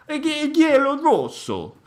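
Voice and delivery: high, slow, hammed-up Italian accent; mock Italian accent. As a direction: stretch it out in playful Italian. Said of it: "this is the Italian video release".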